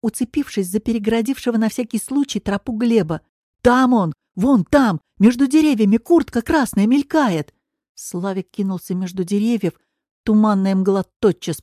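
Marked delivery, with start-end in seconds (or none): 3.6-7.5 louder, higher, urgent cries; raised, excited pointing-out voice; excited calling out. A narrator's reading. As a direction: shout out a sudden sighting. Slavik spots the missing man.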